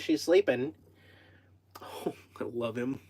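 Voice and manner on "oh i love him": breathy whisper full of affection; loving whisper. Real, not acted. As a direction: whisper it tenderly, overcome with love.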